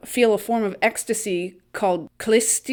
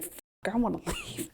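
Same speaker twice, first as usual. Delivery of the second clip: urgent under-the-breath whisper; nervous whisper with a laugh; 10 dB quieter than usual. Retold or imitated. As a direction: whisper it like he's desperate to slip out. Uncomfortable Stefan wants out.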